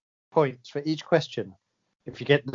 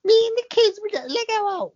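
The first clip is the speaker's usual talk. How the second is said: David Beckham impression. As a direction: put on a gentle, high Beckham voice. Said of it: "doing impressions of all these people".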